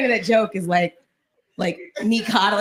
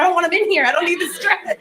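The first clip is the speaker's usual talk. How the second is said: mock dramatic refusal; 8 semitones higher, 7 dB louder, faster than usual. She hides from donuts.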